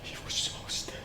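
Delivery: barely voiced, stunned whisper; hushed shock at the sight; horrified whisper. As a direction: whisper it in dread. Recalls seeing the motionless victim.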